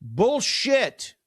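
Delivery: a high, scornful bullshit; emphatic dismissal. Real, not acted. To the host, rejecting the creatives-versus-suits divide, scornful. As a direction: throw it out as nonsense.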